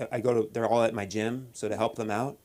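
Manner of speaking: ordinary talk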